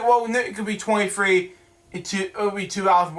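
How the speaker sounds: ordinary talk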